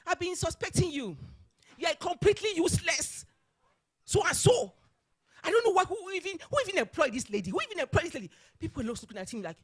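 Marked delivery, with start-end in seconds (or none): 0.1-8.3 imitating an outraged boss, high and loud; raised-voice mock tirade, pitched up high; retold shouting. Chairman berating a chief executive in the boardroom, shouting. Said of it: "the man shouting at her".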